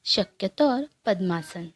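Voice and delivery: guided meditation. Acted. Guide leading listeners through morning breathing meditation, calm and unhurried. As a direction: guide them gently into stillness, leaving long pauses.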